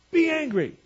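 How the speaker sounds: loud, high, sharply falling exclamation; spring the command on them loudly; shouted command. Shown as a demonstration. Preacher revealing the second training station, shouted command.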